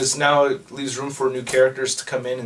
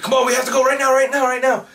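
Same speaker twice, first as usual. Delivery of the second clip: higher, urgent retold plea to run; urgent hurry; 9 semitones higher, faster than usual. Herschel urging Billy to flee, urgent and higher. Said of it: "Herschel's like".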